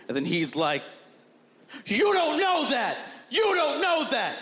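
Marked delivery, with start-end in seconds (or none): 1.9-4.3 high-pitched acted outburst; anguished protest. Widower rejects his friend's comfort, anguished protest. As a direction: imitate an overwrought actor, voice pushed way up. Co-star's angry outburst before punching.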